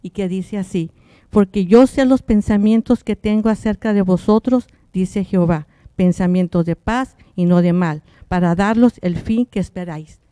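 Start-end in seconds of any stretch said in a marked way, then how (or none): 1.3-10.2 a slightly louder, measured Bible reading; scripture reading. Acted. Sister reads Jeremiah to listeners at home, solemn reading. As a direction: read the verse out to the congregation.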